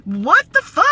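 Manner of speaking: incredulous and fed up; indignant disbelief. Real, not acted. To the audience about the bragging neighbor, indignant disbelief.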